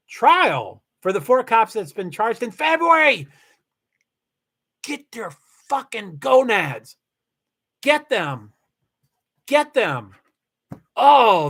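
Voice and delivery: emphatic, punched-out venting; worked-up outrage. Real, not acted. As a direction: hit the key words hard, demanding justice. Venting about the charged cops.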